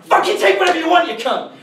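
high, loud furious rant; high-pitched loud mock tirade; acted rant. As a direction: rant at the mugger like you've lost everything. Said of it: "you instantly get aggressive".

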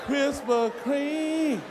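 slow, savoring the name; drawn-out swoon. Real, not acted. Comic reliving hot donut flashback, slow and dreamy. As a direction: stretch it out lovingly.

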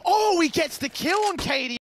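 excited live play-by-play; esports caster. Real, not acted. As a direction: call the action fast and high. Live play-by-play, raised pitch.

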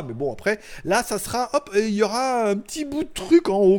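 normal talking voice

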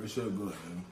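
talking around gum; chewing gum. Real, not acted. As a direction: gum in the mouth while talking. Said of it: "you having a hard time eating that shit".

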